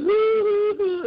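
high loud mock sob hoo hoo; mock sobbing hoo. Telling how she cried at mom's bedside, mock high sobbing. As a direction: imitate her own crying with high wails. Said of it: "I was crying".

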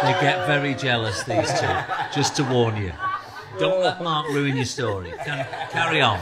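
loud laughing tease; teasing warning through laughter. Real, not acted. Host warns the guest about jealous bandmates, laughing.

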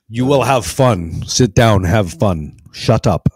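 loud, hammed-up commands; mock stern dictator. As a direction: order people about like a joke dictator. Plays stern Putin ordering fun.

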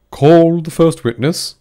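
loud, slow summoning; a king ordering the witness called; royal command. A narrator's reading. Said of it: "said the king".

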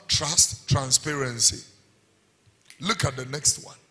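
normal talking voice

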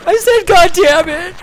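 put-on high, slow teary kid confession; little boy owning up in a high slow voice; tearful little boy. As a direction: squeak it out near tears. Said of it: "He's like".

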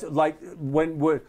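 ordinary talk

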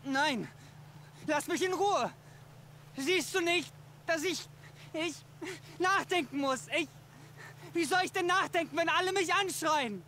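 a man cornered by voices, begging; hunted man begs them to stop shouting, frantic; overwhelmed and frantic. Acted.

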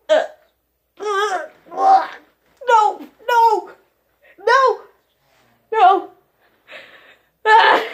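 high, loud cries of no; cringing cries. Real, not acted. Cringing at the whispering in her earbud, high and loud. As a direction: recoil from the sound in your ear.

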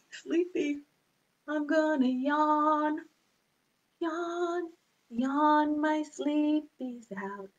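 drowsy soft yawn voice; sleepy. Shown as a demonstration. Sleepy yawning verse.

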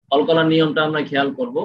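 normal talking voice

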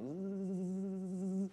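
steady, sustained insect buzz. Retold or imitated. Storyteller imitating the buzzing he heard in the field, long buzz. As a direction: drone like a swarm of flies. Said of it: "I hear all this buzzing".

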